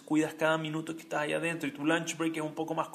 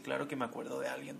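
whisper; 8 dB quieter than usual. Retold or imitated. La mujer susurra.

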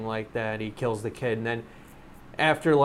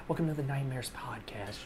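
hushed mock cabin announcement; flight attendant voice; 10 dB quieter than usual. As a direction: softly announce like cabin crew. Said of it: "where's your flight attendant voice?".

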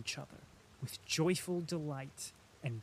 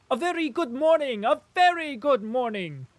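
merry greeting; 9 semitones higher, 10 dB louder than usual. A narrator's reading. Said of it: "he said, winking with a merry smile".

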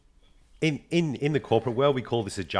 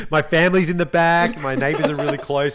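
ordinary talk, nothing marked

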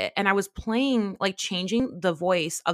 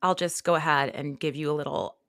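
ordinary talk

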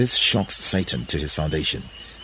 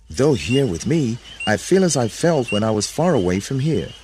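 higher, earnest voice; troubled Adam; 6 semitones higher than usual. A narrator's reading. Adam telling Eve of her absence, raised troubled voice. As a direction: plead the hurt at raised pitch.

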